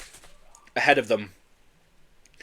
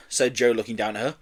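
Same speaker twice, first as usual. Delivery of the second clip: usual speaking voice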